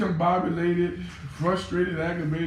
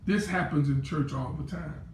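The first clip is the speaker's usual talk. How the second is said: ordinary talk, nothing marked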